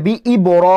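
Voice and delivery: বি ই বড় with a full, rounded "ro", held; heavy R. Shown as a demonstration. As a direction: thicken the r in বড় to "ro", not "ra". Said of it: "এই যে র পড়ছি আমি".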